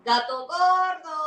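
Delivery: loud slow call across the yard. A narrator's reading. Brother shouting to the cat, loud call. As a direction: bellow the name out.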